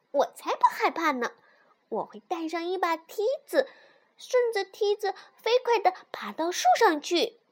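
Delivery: high, proud little fox voice; smug. A narrator's reading. Little fox boasts to mother about the ladder, smug. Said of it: "小狐狸却一脸得意地说".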